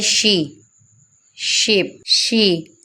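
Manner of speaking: "शी" and "शीप" with a stretched ee; long ee vowel. Shown as a demonstration. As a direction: draw out the ee in "शीप". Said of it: "ई को भी खींच के पढ़ा जा रहा है". ("शीप" में ई को खींचकर बोलें)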